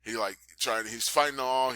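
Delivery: ordinary delivery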